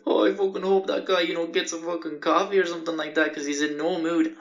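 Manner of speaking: lightly voiced snarky bystander; snide guy behind. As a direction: imitate a cocky man joking behind you. Man behind mocks the clerk.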